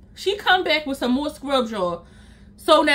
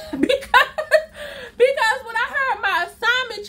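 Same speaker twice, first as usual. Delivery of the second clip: laughing; 9 semitones higher than usual. Real, not acted.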